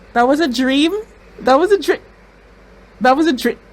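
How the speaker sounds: high, loud stunned stammer; shocked disbelief. Real, not acted. Viewer realising the scene was only a dream, stunned. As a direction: stumble over the words in shock.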